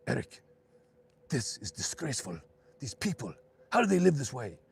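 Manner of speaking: lightly imitated German accent, rising to loud outrage; disapproving mother with a hint of German accent. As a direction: imitate a German mom, building to an indignant cry. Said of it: "in her thick German accent".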